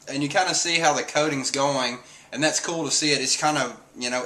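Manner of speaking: ordinary delivery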